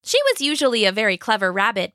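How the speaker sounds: ordinary delivery, nothing marked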